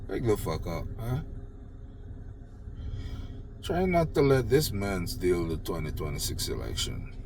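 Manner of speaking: normal talking voice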